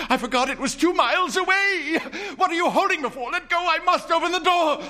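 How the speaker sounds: very high, loud desperate shout; frantic shouting. Acted. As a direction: scream to be let go. Mother struggling to reach door.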